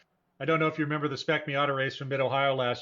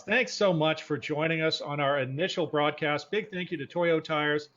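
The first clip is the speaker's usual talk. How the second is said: ordinary delivery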